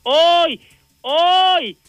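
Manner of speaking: anuncio de pista con hoy creciente; ringmaster. Pretend. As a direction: presentar como en el circo, subiendo. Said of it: "damas y caballeros, niños y niñas". (anunciador de circo)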